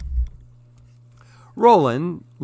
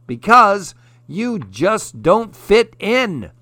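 taunting; 8 semitones higher, 5 dB louder than usual. A narrator's reading. Fox taunting from the tree.

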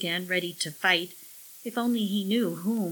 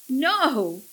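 shouted refusal; 6 semitones higher, 6 dB louder than usual. A narrator's reading. Jake lunges at the alien.

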